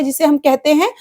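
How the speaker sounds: ordinary delivery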